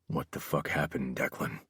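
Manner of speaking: low, very quiet menace; hushed, dangerous insistence; repeated demand. A narrator's reading. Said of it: "I asked again".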